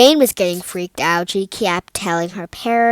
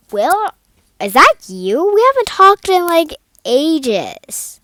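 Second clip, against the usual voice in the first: higher and louder, caught off guard; startled hello to an old friend; surprised, pitch jumping way up; surprised greeting; 9 semitones higher, 6 dB louder than usual. Acted. Girl surprised to hear from ex.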